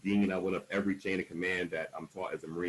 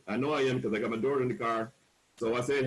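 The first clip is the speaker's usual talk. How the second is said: ordinary delivery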